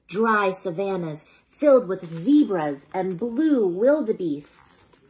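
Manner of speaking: ordinary delivery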